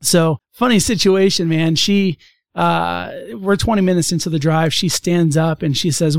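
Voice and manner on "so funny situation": normal talking voice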